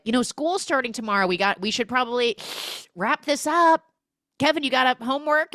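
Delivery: high, loud, hurried; mock frantic hinting. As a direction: rush to break up the party. Retold mother frantically ending the evening.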